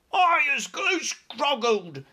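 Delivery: high, loud, slow howl; howling giant. Acted. Chained giant howls under the roaring engines, loud and high. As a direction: howl it like a wounded beast. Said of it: "howled the man hugger".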